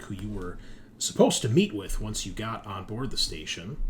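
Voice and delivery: ordinary talk, nothing marked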